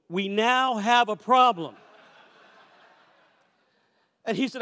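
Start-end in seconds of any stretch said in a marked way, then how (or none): 0.1-1.8 slow, measured punchline; deliberate concession. Retold or imitated. Father giving in to son about the bill, slow deliberate concession. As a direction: spell it out slowly for comic effect.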